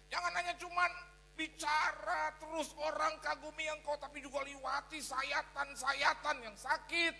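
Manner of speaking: forceful preaching. Acted. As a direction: preach God's words at full force. Quoting God, preaching hard. (khotbah berapi-api, lambat dan keras)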